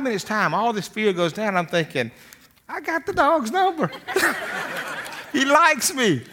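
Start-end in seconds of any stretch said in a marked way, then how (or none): 2.7-3.9 much higher, delighted inner voice; mock-gleeful aside; gleeful triumph. Inner triumph after the call. 3.9-5.3 a quick airy chuckle; real amused laugh; breathy laugh. Real, not acted. Laughs at his own triumph.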